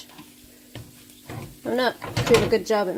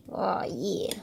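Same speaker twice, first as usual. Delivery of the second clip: whispered; 3 semitones lower, 6 dB quieter than usual. Real, not acted. Pleased at flipped toast.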